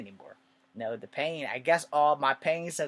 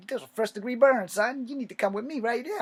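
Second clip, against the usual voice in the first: urgent doctor; 11 semitones higher, 8 dB louder than usual. Retold or imitated. Doctor orders him to come.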